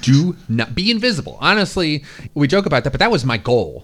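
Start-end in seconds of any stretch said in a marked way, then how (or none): 0.0-1.4 emphatic. Retold or imitated. Stating teenage goal.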